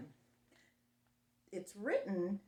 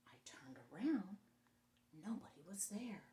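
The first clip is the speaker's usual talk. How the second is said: suspense whisper; 11 dB quieter than usual. A narrator's reading.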